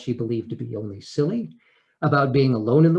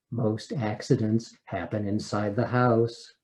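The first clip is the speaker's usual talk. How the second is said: usual speaking voice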